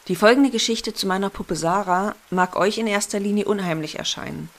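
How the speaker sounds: ordinary delivery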